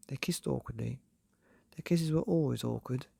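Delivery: usual speaking voice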